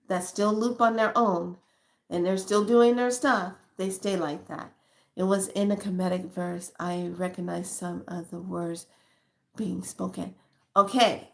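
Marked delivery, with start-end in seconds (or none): none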